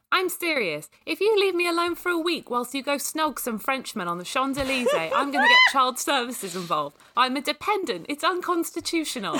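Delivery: put-on high-pitched indignant teenage sister; high, pushy, theatrical protest; stubborn protest. A narrator's reading. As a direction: insist stubbornly, voice pitched right up. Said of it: "Kaylee said stubbornly".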